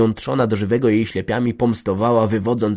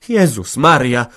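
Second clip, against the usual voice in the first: shocked cry; 4 semitones higher, 7 dB louder, slower than usual. Acted. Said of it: "krzyknęła Józka".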